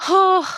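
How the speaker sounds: loud, high, drawn-out "ohh"; a touched wail of "oh"; moved "oh". Real, not acted. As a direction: let out a high, heartfelt "ohh". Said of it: "That really got me".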